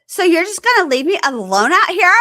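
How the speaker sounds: high, loud hammed-up whine; mock whiny complaint. Imitating the girlfriend whining at the leaving boyfriend, high and loud. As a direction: whine it like she's abandoned. Quoting her shrill complaint.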